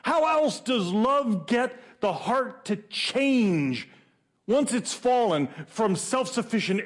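high-pitched, driving preacher delivery; high, insistent pulpit question; impassioned preaching. Real, not acted.

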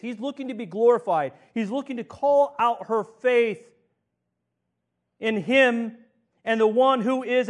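full-voiced pulpit preaching, climbing. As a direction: build the fervour line by line. Preacher building to a climax.

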